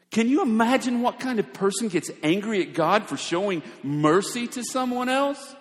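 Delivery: normal talking voice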